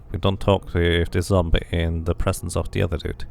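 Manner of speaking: normal talking voice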